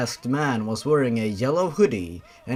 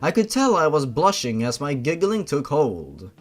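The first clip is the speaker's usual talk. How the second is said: usual speaking voice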